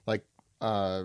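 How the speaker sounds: ordinary talk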